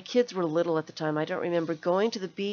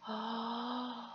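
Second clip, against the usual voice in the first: gasp; 3 semitones higher, 10 dB quieter than usual. Real, not acted. Finds item, surprised.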